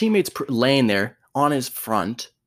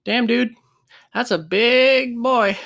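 slow, higher, awed mock voice; awed player; 10 semitones higher, 4 dB louder, slower than usual. Tiny player telling a teammate about being crushed, awed mock. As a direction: marvel at the big guy, drawn out. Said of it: "all I heard was".

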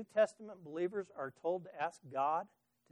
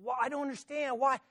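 irritated pleading; 8 semitones higher, 4 dB louder than usual. Retold or imitated. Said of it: "he just got so irritated".

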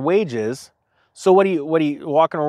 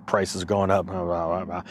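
mock grumble, much lower and softer; low grumbling; 10 semitones lower, 8 dB quieter than usual. Imitating people complaining about prices, low grumbling. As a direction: grumble under your breath, far down in pitch. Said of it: "Just grumbling is low".

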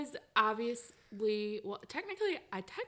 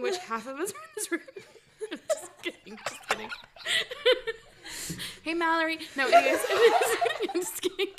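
laughing; 9 semitones higher, 8 dB louder than usual. Real, not acted. Teasing, laughing.

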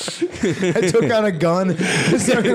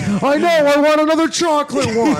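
put-on whiny kid demand; 8 semitones higher, 4 dB louder than usual. Kid demanding another Frosty.

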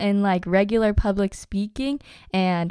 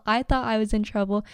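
ordinary delivery

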